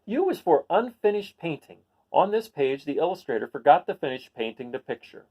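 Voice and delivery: picture-book read-aloud voice; reading to children. Acted. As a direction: read it to a class of kids.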